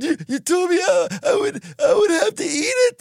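put-on high tearful little boy; crying child. Six-year-old answering his dad, high and crying. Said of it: "I said".

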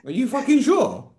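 put-on high, scolding Greek-accented mum. Strict mum telling off her kid, Greek-accented mum voice.

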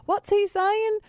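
exaggerated high Southern drawl; Southern accent. Producer mimics confused callers, mock high Southern accent. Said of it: "everybody who's from the South".

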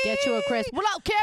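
high, loud holler; host calls out to listener Carrie, high hyped holler; hyped shout-out. Real, not acted. Loud, high-pitched greeting cheer.

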